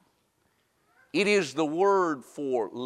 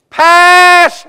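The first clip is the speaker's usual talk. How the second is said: "passed" shouted much higher and louder; stress on passed; 13 semitones higher, 17 dB louder than usual. Shown as a demonstration. Preacher underlining the word for his congregation, "passed" shouted high. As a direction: hit "passed" hard as a cry. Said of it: "I wanted to underline that word".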